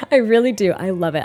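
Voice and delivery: ordinary talk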